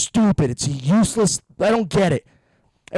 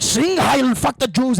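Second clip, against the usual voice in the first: hammed-up barked yell, higher; mock Nazi dad; 6 semitones higher, 5 dB louder, slower than usual. Comic imagines a Nazi father ranting at his son, mock yell. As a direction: bark it like a cartoon tyrant parent.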